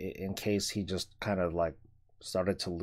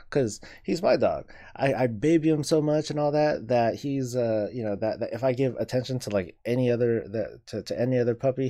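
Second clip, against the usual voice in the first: usual speaking voice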